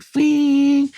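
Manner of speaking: high, loud ringing "fing" like a ringing ear; imitated ear ringing. Telling a friend about his father being shot, a high, loud ringing sound. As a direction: mimic the whine in his ears after the gunshot. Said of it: "he heard this ringing in his ears".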